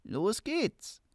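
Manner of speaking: high, soft devil voice; smooth polished devil. Acted. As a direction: purr it like a sly devil. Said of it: "sagte das Tier mit des Teufels Stimme".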